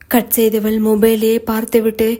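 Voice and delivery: ordinary delivery, nothing marked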